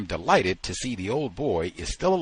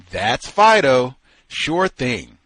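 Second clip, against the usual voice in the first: loud, drawn-out delighted recognition; lit-up boy; 4 semitones higher, 10 dB louder, slower than usual. A narrator's reading. Boy recognizes his lost dog, loud and delighted. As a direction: call it out brightly, slow and emphatic. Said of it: "The blonde angel's face lit up".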